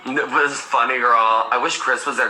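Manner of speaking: normal talking voice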